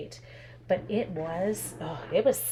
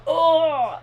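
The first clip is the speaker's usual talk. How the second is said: disgusted gasp; 8 semitones higher, 8 dB louder than usual. Retold or imitated. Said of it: "I was like".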